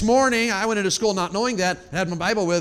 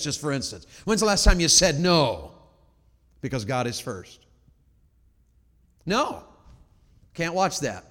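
ordinary delivery

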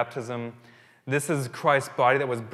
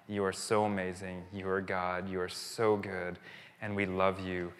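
prayer; 4 semitones lower than usual. Acted. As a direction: pray it humbly and gently. Leads closing prayer.

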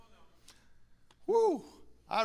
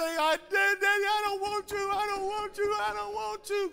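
put-on scared little boy; 13 semitones higher than usual. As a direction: beg like a frightened kid. Boy pleading, higher.

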